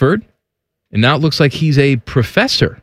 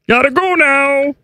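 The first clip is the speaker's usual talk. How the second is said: high puppet-frog voice; Kermit; 9 semitones higher, slower than usual. Acted. Kermit saying goodbye and greeting a colleague, high and strained. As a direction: sign off in a squeezed frog voice.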